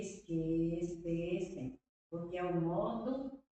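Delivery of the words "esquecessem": drawn out slowly; teacher spelling out the verb slowly; slow demonstration. Shown as a demonstration. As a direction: say it slowly to show the ending. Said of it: "Aí sim, ó".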